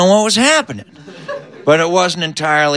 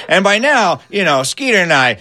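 plain reading voice

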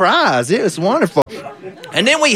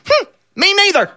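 much higher, squeaky dog voice; little dog voice; 13 semitones higher than usual. Acted. Farmer's dog answers him in a high squeaky voice. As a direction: pitch it way up like a small dog.

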